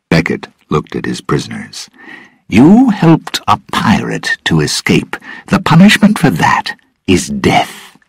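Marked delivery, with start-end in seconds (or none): 2.5-8.0 higher-pitched, mildly pleased pronouncement; happily. A narrator's reading. Lord tells his prisoners their punishment, happily. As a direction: pass sentence with a touch of relish. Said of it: "he said happily".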